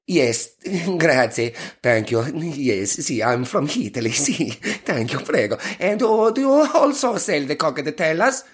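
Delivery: laughing through a hammed-up Italian accent, slow; mock Italian accent. Shown as a demonstration. Chatting to the barman in mock Italian accent. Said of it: "order your drinks in a northern Italian accent".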